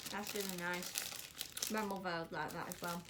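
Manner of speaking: talking with mouth full. Real, not acted. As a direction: speak around a mouthful of food. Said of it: "I'm talking with my mouth full".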